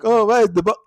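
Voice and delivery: high, loud but unbothered oh; casual, not in peril. Retold or imitated. Actress in a shootout asking for the box, casually unbothered. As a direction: search for the box as if nothing is at stake. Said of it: "You can tell that they're not actually in peril".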